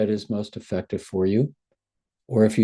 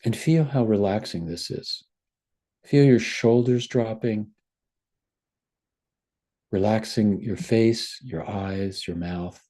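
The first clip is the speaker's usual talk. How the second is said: guided meditation. Acted. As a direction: gently guide them into relaxation. Guided body relaxation.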